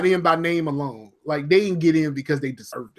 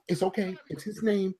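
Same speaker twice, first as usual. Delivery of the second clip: much softer reassurance; casual demonstrated aside; 11 dB quieter than usual. Shown as a demonstration. Coaching a friend past his worry, quiet aside. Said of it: "this is what you do. You go".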